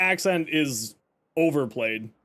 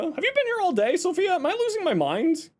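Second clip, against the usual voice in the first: a frazzled squeak at a chatter; squeaky high mock panic; 13 semitones higher than usual. Real, not acted. Greets chatter Sophia amid lizard spam.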